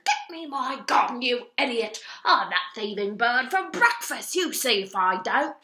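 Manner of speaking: loud, furious ranting at the gardener; angry duke shouting. A narrator's reading. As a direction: bark orders at the idiot gardener. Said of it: "the Duke was shouting to the gardener".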